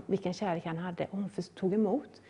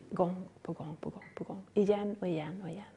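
much softer, fading into a whisper; hushed whisper; 2 semitones lower, 9 dB quieter than usual. Acted. Preacher hushing as she describes God's endless love, whispered. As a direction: let it sink in, barely voiced.

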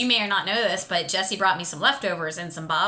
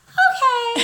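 guest tasting; 5 dB louder than usual. Retold or imitated. Imitating guests tasting punch.

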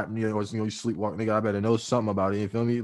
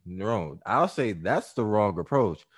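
ordinary delivery

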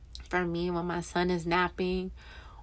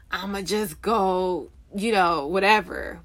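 lightly imitating her own dismissive attitude, "whatever" a bit louder; mock attitude; 4 dB louder than usual. Recalling how she brushed off her feelings, with mild sass. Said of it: "with an attitude".

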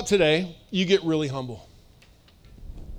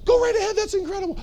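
high, over-excited oh my gosh; flattering friend gushing over any plan; 3 dB louder than usual. Acted. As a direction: squeal the praise like a yes-man.

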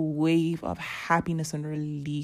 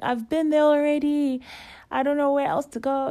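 higher and a bit quicker, exasperated; pleading in frustration, running out of options; 9 semitones higher than usual. Retold or imitated. To a stranger giving directions, exasperated and higher.

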